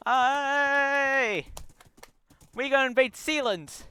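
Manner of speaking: high, loud YouTuber yell; hammed-up hype-man video intro; PewDiePie. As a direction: draw out the greeting, then shout the announcement. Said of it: "gonna be a video by PewDiePie".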